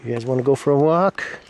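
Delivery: rally the dogs for a walk; excited call to dogs. Real, not acted. Owner calling her dogs to a walk, excited and loud.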